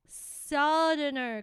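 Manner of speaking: southerner said slowly as SUH-dern-er; drawn-out southerner, short u; slow practice word. Shown as a demonstration.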